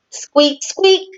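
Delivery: high squeaking sound; high-pitched squeak squeak; squeaky creak. A narrator's reading. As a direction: squeak like a rusty ride. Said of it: "merry-go-round sound".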